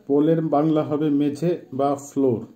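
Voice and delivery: ordinary delivery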